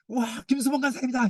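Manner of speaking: high, cheery greeting voice; mock happy greeting. A gathering happily greeting the pastor, high and bright. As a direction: greet a favourite like a crowd would. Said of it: "이게 아니라".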